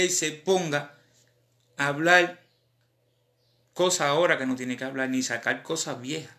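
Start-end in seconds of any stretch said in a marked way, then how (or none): none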